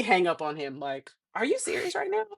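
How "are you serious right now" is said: high-pitched retold disbelief; incredulous. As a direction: squeak it out in exasperated disbelief. Angry reply to husband's twins remark.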